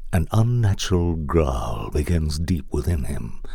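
ordinary delivery

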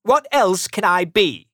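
high, loud grumpy question; irritated retort. A narrator's reading. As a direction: argue back as a cross uncle. Scrooge argues with nephew.

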